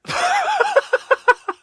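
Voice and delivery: real loud laughing burst; loud high laugh. Real, not acted. Laughs at own joke.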